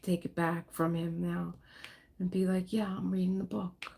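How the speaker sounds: ordinary delivery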